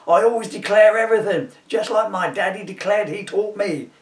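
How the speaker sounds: high, loud righteous electrician; 1 loud indignant Max. A narrator's reading. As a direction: protest your honesty at full voice. Max voiced protesting.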